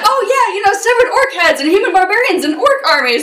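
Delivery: high, loud, laughing reply; gleeful players. Retold or imitated. Players telling hotel staff about severed orc heads, laughing and high. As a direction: brush it off with giddy glee.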